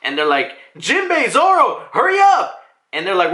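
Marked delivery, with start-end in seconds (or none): 0.8-2.6 high, urgent mock yell to crewmates; high-pitched hollered hurry-up; calling out. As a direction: call each name, then rush them. Crew calling out to two stragglers.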